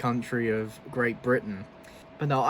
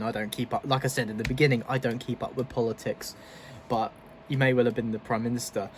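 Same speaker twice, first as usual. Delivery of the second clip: normal talking voice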